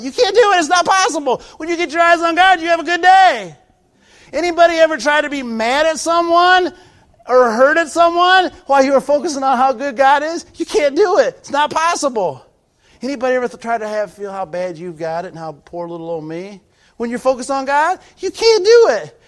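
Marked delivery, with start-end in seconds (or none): 0.0-12.4 loud, high, slowing preacher's cry; full-force preaching. Acted. Pastor assuring the sisters, loud fervent preaching. 17.0-19.2 loud, high, slowing preacher's cry; full-force preaching. Acted. Pastor assuring the sisters, loud fervent preaching.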